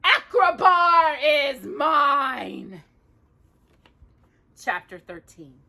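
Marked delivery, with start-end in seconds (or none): 0.0-2.8 high, loud gloating villain; triumphant shout. Acted. Jafar shouts his victory to Iago, loud gloating triumph. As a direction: bellow the conquest, drawing out the end. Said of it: "Jafar shouted".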